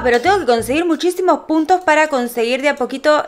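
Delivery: normal talking voice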